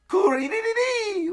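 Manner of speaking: high-pitched little creature screech; screaming. Acted. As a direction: squeal out a mock scream in a tiny high voice. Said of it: "Little Ragnir's screaming as well".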